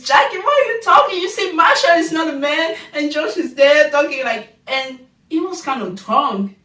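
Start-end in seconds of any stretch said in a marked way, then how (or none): 0.0-4.6 put-on loud whiny baby voice, high. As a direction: shout it like a drunk whiny baby. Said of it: "Josh with her baby voice".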